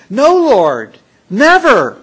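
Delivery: loud, high protest; Peter's loud emphatic no; emphatic refusal. Acted. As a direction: cry out no, refusing it. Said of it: "Peter was emphatic".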